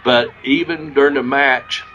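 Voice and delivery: ordinary talk, nothing marked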